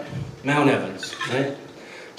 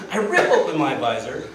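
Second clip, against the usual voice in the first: ordinary talk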